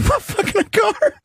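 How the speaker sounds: high, slow words through laughter; laughing. Real, not acted. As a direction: say it while cracking up. Repeating misheard line, cracking up.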